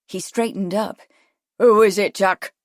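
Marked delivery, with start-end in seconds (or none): 1.6-2.5 loud urgent call. A narrator's reading. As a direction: shout the question down. Shouting down to the beach.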